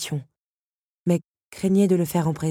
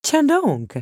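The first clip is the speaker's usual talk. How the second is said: ironique, méprisant; 11 semitones higher, 4 dB louder than usual. A narrator's reading. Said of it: "ironisa Edward, un rien méprisant".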